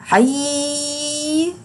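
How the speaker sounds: teacher drawing out عيد; long drawn vowel. Shown as a demonstration. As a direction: stretch عيد for the class. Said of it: "وبطول في صوته".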